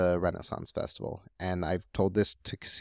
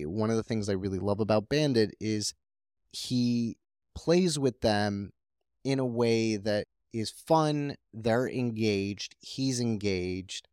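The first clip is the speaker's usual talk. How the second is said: ordinary talk, nothing marked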